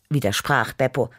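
usual speaking voice

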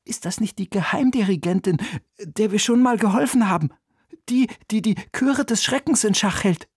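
put-on high, excited boy's voice, stumbling; boy confiding. A narrator's reading. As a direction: ask eagerly as a young boy. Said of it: "flüsterte Oliver". (hohe, aufgeregte Jungenstimme)

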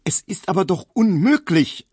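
high, loud wailing protest; shrill protest. A narrator's reading. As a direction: cry out in desperate outrage. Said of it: "zeterte Emil Kubinke".

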